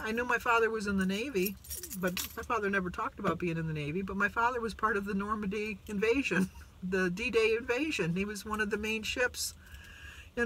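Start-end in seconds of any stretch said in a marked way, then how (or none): none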